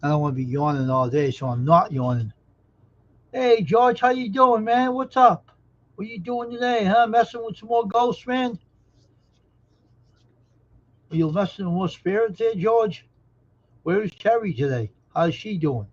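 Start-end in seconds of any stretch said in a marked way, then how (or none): 3.3-4.0 much higher, louder hey; excited greeting. Real, not acted. Greeting a viewer in chat. 4.0-4.9 much higher and louder how you doing; excited greeting. Real, not acted. 4.9-13.1 high-pitched, lively questions; greeting a regular in chat, higher and excited; excited chatter. Real, not acted. Keeps greeting George.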